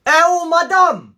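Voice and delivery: loud slow call across the yard; drawn-out hail to the teacher. Acted. Calling out to teacher.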